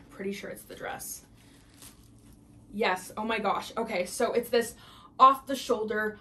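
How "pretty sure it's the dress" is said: whispered guess. Real, not acted. Peeking into package, whispering guess.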